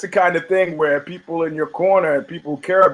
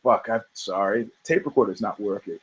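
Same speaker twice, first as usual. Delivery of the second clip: sheepish journalist; 7 semitones lower, 3 dB quieter than usual. Retold or imitated. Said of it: "He goes".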